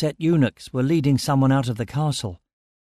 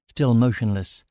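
ordinary delivery

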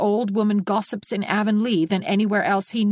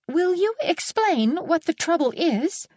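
slow, high and very proper; dignified; 10 semitones higher than usual. A narrator's reading. Anne asks the angry neighbour, slow and dignified. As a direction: ask with a lifted chin, politely. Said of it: "in her most dignified manner".

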